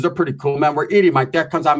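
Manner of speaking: usual speaking voice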